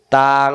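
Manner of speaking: তাং drawn out on a nasal ng; hidden nun. Shown as a demonstration. Teacher showing nun sakin before fa, long nasal তাং. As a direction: hold তাং humming through the nose. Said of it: "গোপন করে পড়তে হবে".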